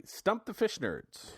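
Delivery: high, slow drawn-out title; slow sing-out show title; segment announcement. Real, not acted.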